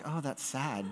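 soft imitation of a pitying audience; hushed little "aww" reaction; mock sympathy. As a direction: quietly mimic the listeners' pity. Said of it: "you were all were like".

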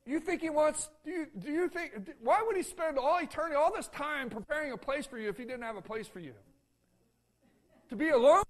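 loud, high-pitched preacher's appeal; fervent pulpit exhortation; preaching at full force. Acted. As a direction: drive the question home to the pews.

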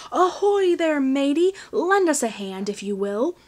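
loud, high pirate hail; pirate holler. A narrator's reading. Pirate captain calling Finn over, hearty holler. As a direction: bellow across the lot like a pirate captain. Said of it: "hollered out".

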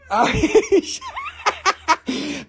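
long, high-pitched laugh; helpless laughter. Real, not acted. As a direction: crack up completely. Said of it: "y'all making me laugh".

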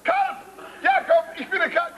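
shrieked high, then indignant; outraged outcry. Acted. Father reports the insult to Jakob, outraged outcry. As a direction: yelp the insult back in outrage.

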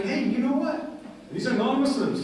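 a light mock of the curious guests; delegates' remark. Delegates quoted, they're like.

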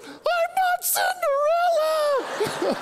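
very high hammed-up child's wail; mock crying. Granddaughter retold when the shoe won't fit, mock crying wail. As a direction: wail it sky-high like a crying little girl. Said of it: "she starts crying".

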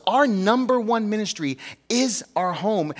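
slow each word of "our number one ministry is our home"; slow emphatic statement. Real, not acted. Pastor driving his main point home, slow. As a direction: lean on "number" and draw the line out. Key line restated slowly.

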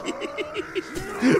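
high wheezy giggle; squeaky burst of laughter; laughing. Real, not acted. Laughing at the enemy flying off.

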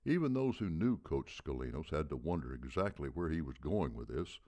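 usual speaking voice

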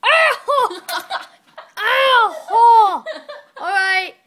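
high loud ow, laughing; pained laughing yelp. Real, not acted. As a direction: yelp in pain through laughter. Hit on head with crayon.

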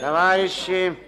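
loud, high call to order; addressing the room. Acted. Opening a meeting. (громкое обращение к собранию)

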